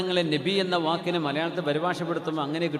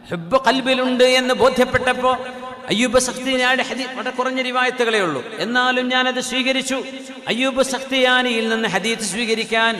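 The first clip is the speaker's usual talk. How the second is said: raised, sustained sermon voice; impassioned preaching; 7 semitones higher, 5 dB louder than usual. Acted. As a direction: build to a loud, intoned climax.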